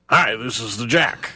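slow, put-on voice of a co-host. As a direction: do a slow impression of your colleague. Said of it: "Do a Jordan D".

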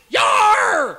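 high, loud Scottish yell; mock Scottish. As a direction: bellow a cartoon Scottish cry. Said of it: "I can do a good Scottish".

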